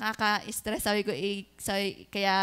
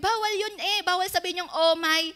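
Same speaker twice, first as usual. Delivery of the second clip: put-on child's scolding; 6 semitones higher than usual.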